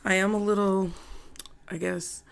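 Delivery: ordinary delivery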